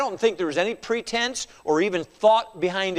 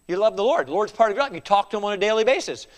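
ordinary talk

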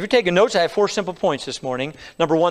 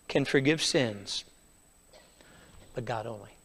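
slow, lower, hushed title; quiet emphasis; 9 semitones lower, 12 dB quieter than usual. Shown as a demonstration. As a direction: let the question sink in softly. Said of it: "the title of the message".

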